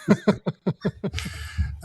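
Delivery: a real little laugh; airy laugh. Real, not acted. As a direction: laugh at your own one-liner. Guest laughs at own joke.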